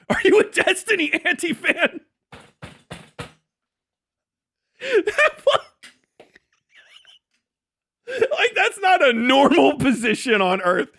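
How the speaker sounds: high, laughing disbelief at a chatter. Real, not acted. Streamer laughing at a chatter's odd position. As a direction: laugh through the question in disbelief.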